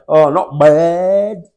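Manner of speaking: slow, drawn-out "Oh, not bad" with a bored bleh. Mimicking the locals' greeting reply, slow and bored bleh. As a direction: drag "Oh, not bad" out slowly, unimpressed. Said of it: "There's a, there's a sort of a bleh".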